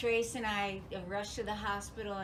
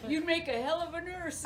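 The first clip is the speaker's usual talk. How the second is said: laughing; 8 semitones higher, 4 dB louder than usual. Retold or imitated. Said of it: "He's like".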